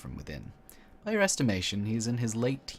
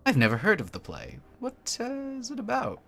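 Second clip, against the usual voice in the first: higher, startled cultist woman voice; wide-eyed cultist; 4 dB louder than usual. Acted. Cultist woman asking about the play, higher and startled. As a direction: ask in wide-eyed surprise.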